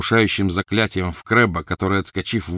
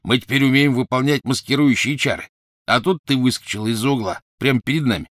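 higher, louder lightly voiced dim thug; grunting Goyle; 6 semitones higher, 5 dB louder than usual. Goyle boasts to Harry, a light grunting dim thug. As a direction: grunt it out smugly. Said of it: "хрюкнул Гойл".

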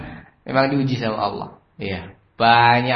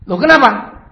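high, loud startled boss; acted shock, pitched way up; shocked reaction; 10 semitones higher, 11 dB louder than usual. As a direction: burst out in alarmed disbelief. Boss reacts to bad news.

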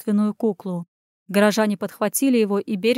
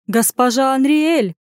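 high, slow, louder cry of recognition; exclaiming; 4 semitones higher, 5 dB louder, slower than usual. A narrator's reading. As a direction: exclaim the name, drawn out. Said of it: "воскликнула Веста".

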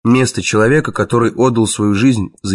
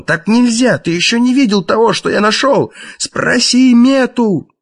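desperate shout; 13 semitones higher than usual. A narrator's reading. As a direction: call out urgently before the door shuts. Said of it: "крикнул Езон".

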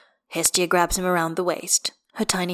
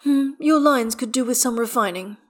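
higher, mildly arch critique; dry; 6 semitones higher, 4 dB louder than usual. A narrator's reading. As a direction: offer it with dry, teasing disapproval. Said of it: "Astarion says dryly".

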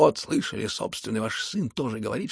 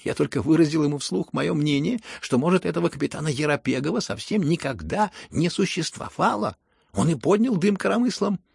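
loud, pitched-up, taunting protest; mocking insistence; 4 semitones higher, 6 dB louder than usual. A narrator's reading. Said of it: "тем же насмешливым тоном".

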